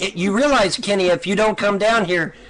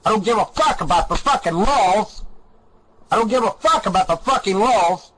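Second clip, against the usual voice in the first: ordinary talk, nothing marked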